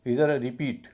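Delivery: usual speaking voice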